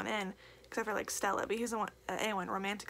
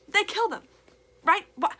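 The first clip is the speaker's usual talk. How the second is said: higher, much louder outcry; distressed outcry; 10 semitones higher, 11 dB louder than usual. Real, not acted. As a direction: cry it out in disbelief. Outraged over character death.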